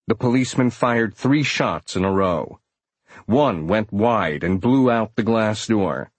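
ordinary delivery

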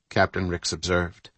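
ordinary delivery, nothing marked

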